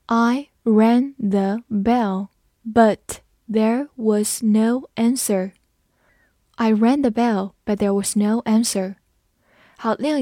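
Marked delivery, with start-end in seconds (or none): 0.1-5.5 word by word, very slow, with clear gaps; slow read-along. Shown as a demonstration. Teacher leading learners through the doorbell sentence, slowly. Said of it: "跟着我慢慢来读".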